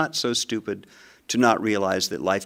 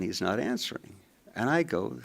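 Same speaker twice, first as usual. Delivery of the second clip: ordinary delivery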